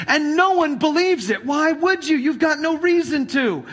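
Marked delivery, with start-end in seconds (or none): none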